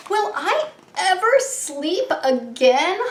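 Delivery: high-pitched plaintive question; sighing lion. A narrator's reading. Arlo the sleepless lion wonders aloud, sighing. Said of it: "Arlo sighed".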